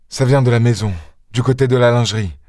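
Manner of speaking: usual speaking voice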